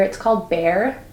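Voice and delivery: ordinary talk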